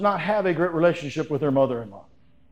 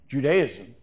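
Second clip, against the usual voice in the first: ordinary delivery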